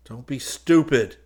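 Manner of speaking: gritted. A narrator's reading. Solo hissing at Golgotha, gritting it out. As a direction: bite the words off tight. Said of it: "he gritted".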